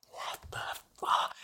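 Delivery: stunned whisper. Retold or imitated. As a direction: breathe it out in shock. Guy's stunned reaction.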